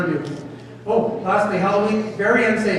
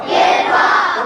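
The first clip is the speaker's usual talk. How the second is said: group shouting the word; 10 semitones higher, 4 dB louder than usual. Real, not acted. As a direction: shout the word back together. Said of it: "One more time".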